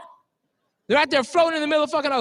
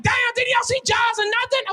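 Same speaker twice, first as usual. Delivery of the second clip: incredulous moviegoer; 9 semitones higher than usual. Retold or imitated. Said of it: "The brother was like".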